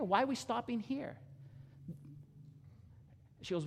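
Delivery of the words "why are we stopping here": mildly incredulous, pitched high; puzzled question. Retold or imitated. Driver asks the girl about stopping, high and puzzled. Man voiced puzzled at the stop.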